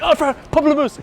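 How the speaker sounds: sing-song tease of the French word, way up high; playful pamplemousse. Retold or imitated. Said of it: "'cause we both like".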